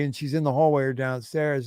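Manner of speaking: normal talking voice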